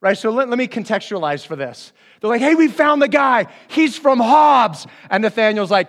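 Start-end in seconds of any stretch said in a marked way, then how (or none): 2.4-4.9 loud, high, hammed-up excitement; excited announcement. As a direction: call it out proudly, stretching the hometown. Disciples excitedly announce, retold locally.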